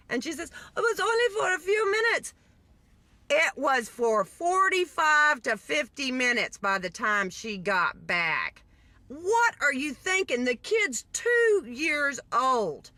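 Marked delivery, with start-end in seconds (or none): 0.8-2.3 high mock Jamaican accent; imitated Jamaican mother. Mother excuses herself to officer, high Jamaican imitation. 3.3-12.9 slow, emphatic outrage; upset, indignant. Real, not acted. Storyteller condemns leaving toddler alone, real indignation. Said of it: "I'm a little upset about this".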